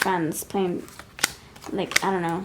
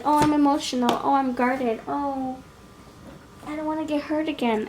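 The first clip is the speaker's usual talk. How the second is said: higher-pitched mock voice of a self-pitying ex; mock whiny ex; 6 semitones higher than usual. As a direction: lightly parody the dramatic ex, pitched up. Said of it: "They can be like".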